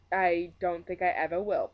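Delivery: usual speaking voice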